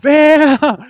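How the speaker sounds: high, loud incredulous question; startled disbelief. Acted. Dreamer picked by the giant, acted startled disbelief. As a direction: shout it in mock disbelief.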